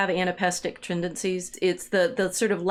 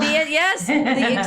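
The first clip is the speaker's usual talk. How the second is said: excited high yes; 8 semitones higher, 7 dB louder, slower than usual. Real, not acted. Excited agreement.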